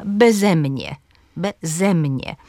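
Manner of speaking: "bezemnie" as be-ZE-mnie; stress second syllable. Shown as a demonstration. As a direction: lean on ZE in "bezemnie", repeating it carefully. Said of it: "drugą sylabę tego przyimka". („bezemnie” z akcentem na ZE)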